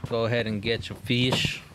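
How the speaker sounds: ordinary talk, nothing marked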